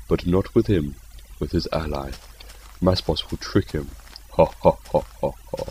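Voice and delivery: hushed, scheming narration in third person; mock storyteller. Narrating his trap for the creeper, mock-sinister. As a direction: narrate your cunning plan softly, like a villain. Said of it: "Don't know why I'm talking like this".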